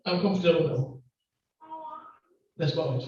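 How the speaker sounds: ordinary talk, nothing marked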